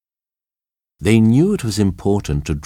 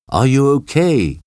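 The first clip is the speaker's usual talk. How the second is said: higher-pitched question called across a distance; raised, carrying question; calling out; 7 semitones higher, 3 dB louder than usual. A narrator's reading. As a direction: call over to check on someone far off. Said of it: "called John".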